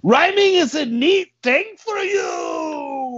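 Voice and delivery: loud, very high, slow holler; hammed-up dopey shout; Tough Carlos. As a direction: holler it and stretch the last word. Tough Carlos repeats his comment.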